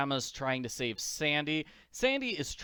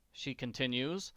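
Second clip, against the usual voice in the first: ordinary delivery, nothing marked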